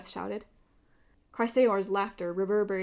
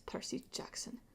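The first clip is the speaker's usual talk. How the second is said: menacing whisper; 5 semitones higher, 14 dB quieter than usual. A narrator's reading. Villain taunting Percy.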